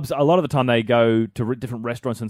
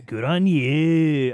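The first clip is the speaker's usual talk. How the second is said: very slow stretched words; drawn-out catchphrase; much slower than usual. Acted. Host echoing co-host, catchphrase dragged very slowly. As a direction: drag it out lazily.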